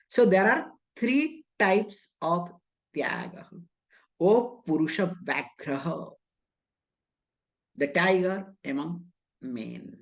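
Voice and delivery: normal talking voice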